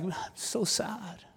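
high, soft, drawn-out mock misery; mock weeping. Satan answering the angel, mock weepy and slow. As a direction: imitate a sorry sobber, slow and small. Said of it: "uncontrollably sobbing".

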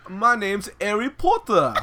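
put-on slow, high, loud English accent; hammed-up English boy wizard introducing himself; Harry Potter accent. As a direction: draw it out in mock British. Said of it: "your best Harry Potter accent".

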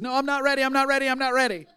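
imitating panicked, high-pitched rapid repetition; retold overwhelmed protest, fast and high; frantic refusal. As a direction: blurt it over and over in one rising rush.

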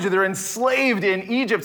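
normal talking voice

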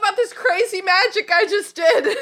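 high, loud laughing mock howler; laughing howler read. Host mocking a howler to aunt Petunia, laughing high. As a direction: squeal it through laughter like a silly letter. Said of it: "the last howler was like".

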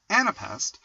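ordinary delivery

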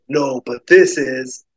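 slow, gruff cowboy reply. Acted. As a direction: drawl it like a menacing cowboy. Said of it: "a gruff cowboy says".

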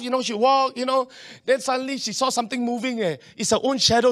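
ordinary talk, nothing marked

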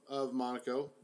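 normal talking voice

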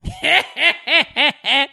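high mock laugh; put-on burst of high laughter; imitated delayed laugh. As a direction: imitate a co-host laughing at the joke. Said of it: "You do that".

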